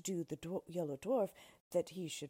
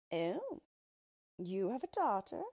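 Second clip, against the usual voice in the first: eager surprise; 4 dB louder than usual. Acted. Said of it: "cried the Yellow Dwarf".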